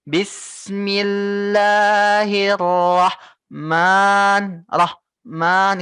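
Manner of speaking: Rahman broken off after Bismillahir, cut apart; wrong separated reading. Shown as a demonstration. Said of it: "Jangan kamu bacanya seperti ini". (contoh bacaan salah, Rahman terpisah)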